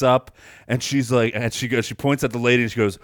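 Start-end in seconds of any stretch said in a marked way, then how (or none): none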